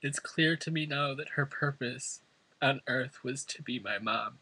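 usual speaking voice

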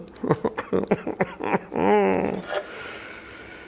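real drawn-out chuckle, mostly breath; long chuckle. Real, not acted. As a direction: chuckle on and on at yourself. Laughing at own repeated mistake.

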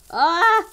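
high loud wail of alarm; startled cry. Real, not acted. Player surprised while checking loot, high loud cry.

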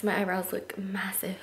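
hushed aside to herself; whispering. Real, not acted. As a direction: drop to a whisper. Aside about her eyebrows.

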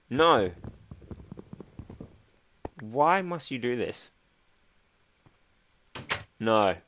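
loud frustrated outburst; exasperated yelling at the game; frustrated shout. Real, not acted.

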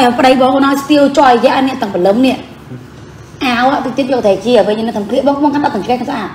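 ordinary delivery

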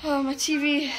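usual speaking voice

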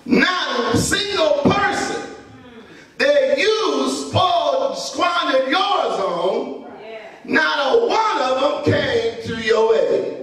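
slow, shouted sermon cadence; drawn-out preacher's cry with long pauses; preaching at full force. Acted. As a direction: hammer each line home to the congregation.

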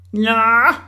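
high, loud jeering howl; howling shout. A narrator's reading. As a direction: howl it up at someone far above. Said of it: "hylede Orla".

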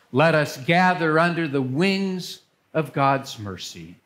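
usual speaking voice

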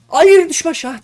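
frantic high shout; panicked no. Real, not acted. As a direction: scream for him not to fall. Teammate about to fall.

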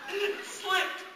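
high, loud eager pup; frantic happy dog; excited dog voice. Acted.

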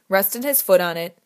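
ordinary delivery

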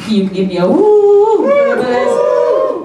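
usual speaking voice

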